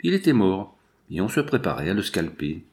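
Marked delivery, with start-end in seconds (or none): none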